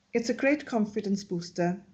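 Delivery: ordinary talk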